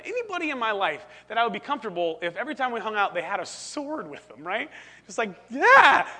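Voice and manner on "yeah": loud, high exclamation; acted protest. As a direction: burst out with a raised, loud voice. Acting out a friend's protest.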